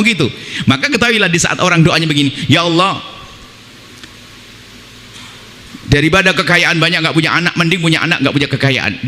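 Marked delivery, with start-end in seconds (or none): 2.5-9.1 a grumbling, reproachful plea to God; mock the bitter complaint; ungrateful prayer lightly acted; protesting prayer. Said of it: "Dia sebenarnya protes pada Allah".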